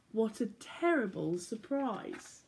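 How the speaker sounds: usual speaking voice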